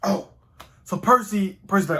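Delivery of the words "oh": usual speaking voice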